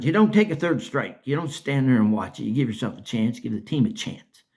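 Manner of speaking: ordinary talk, nothing marked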